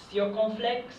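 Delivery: teacher naming the accent "circonflexe" in French. Shown as a demonstration. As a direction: say "circonflexe" as a French word, bright and high. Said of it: "In English, it's circumflex".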